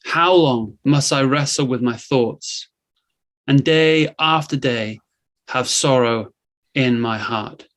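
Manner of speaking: ordinary talk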